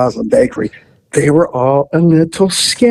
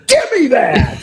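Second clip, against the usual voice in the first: stern demand; 10 semitones higher than usual. Retold or imitated. Grabbing shotgun back.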